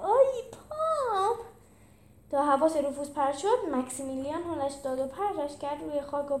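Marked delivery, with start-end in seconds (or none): 0.0-1.4 high, slow, drawn-out cry of pain; a stretched, high yelp of pain; pained scream. Acted. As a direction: wail it like your foot was stomped. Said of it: "روفوس جیغ زد".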